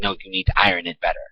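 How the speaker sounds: high, fast nagging mom voice, mock; a mocking put-on of a nagging mother, high and quick; imitating mom. Teen mocks his mom's ironing order, high fast nag. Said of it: "She was like".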